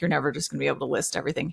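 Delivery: quiet, fast, deadpan. Retold or imitated. Husband Ezra doubting her plan, quick quiet deadpan. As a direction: toss it off quickly and softly. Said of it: "he just kinda looked at me like".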